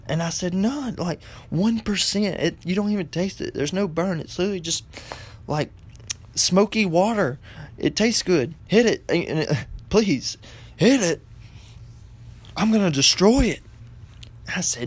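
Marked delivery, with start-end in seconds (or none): 0.5-11.2 high, insistent retold sales pitch; coaxing. Son coaxing mother about the vape. 12.6-13.6 high, loud mock-mom threat; angry threat. Angry mother threatens, retold.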